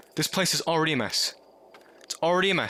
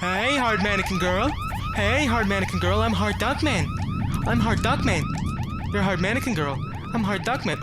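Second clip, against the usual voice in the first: nervous excited greeting; 8 semitones higher than usual. Real, not acted.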